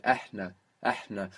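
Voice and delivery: إحنا with an open, airy H, said softly; إحنا twice, the H clearly breathed; breathy H demo. Shown as a demonstration. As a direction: model إحنا, breathing on glass. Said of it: "Really want to hear that breath in there".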